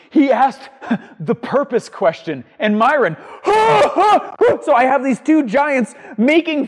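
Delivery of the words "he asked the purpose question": words forced out between laughs, slow and high; laughing while talking. Retold or imitated. Mentor barely answering through laughter, laughing. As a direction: gasp the line through laughter. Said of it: "takes a breath long enough to answer".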